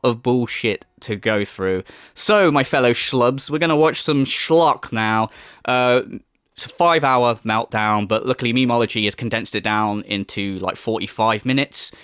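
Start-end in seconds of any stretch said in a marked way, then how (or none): none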